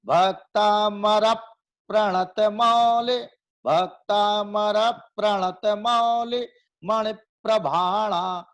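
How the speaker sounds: slow chant with weight on र before प्रणत; stressed recitation. Shown as a demonstration. Teacher demonstrating the stress, slowly chanting the verse. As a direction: recite Bhaktamar प्रणत Moule slowly, leaning on र. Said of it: "वो कैसे जोर देंगे?".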